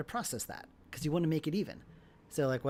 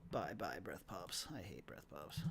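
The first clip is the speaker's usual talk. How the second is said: zacht; 6 semitones lower, 12 dB quieter, slower than usual. Real, not acted. Mutters while editing.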